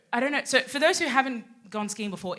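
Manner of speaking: normal talking voice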